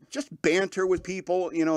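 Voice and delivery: normal talking voice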